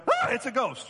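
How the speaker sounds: mock ghost scare. Disciples seeing Jesus on water, mock fright. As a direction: yelp it like a spooked fisherman. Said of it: "just like that".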